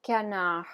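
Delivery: French "canard", the D left unspoken; silent final D. Shown as a demonstration. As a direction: end "canard" on "ah", no D. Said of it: "gonna be pronounced canard".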